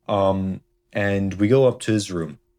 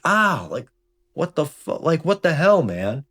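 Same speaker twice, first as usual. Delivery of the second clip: high, louder yelp of pain and shock; retold pained outburst; 9 semitones higher, 3 dB louder than usual. Kid hit with a Nerf gun yelling at his friend in high pained outrage. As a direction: cry out as if just smacked in the eye.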